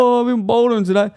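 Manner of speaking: mocking imitation. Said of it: "your shitty little".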